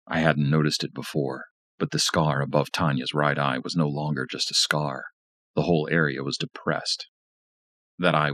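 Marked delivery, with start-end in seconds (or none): none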